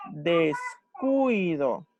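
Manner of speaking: "descuido" stretched very slowly, every sound held; drawn-out word. Shown as a demonstration. Teacher to students, stretching the example word slowly. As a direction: draw "descuido" out slowly for the class. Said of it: "dos vocales juntas que son cerradas".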